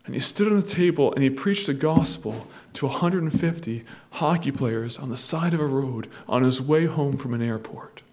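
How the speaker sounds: ordinary talk, nothing marked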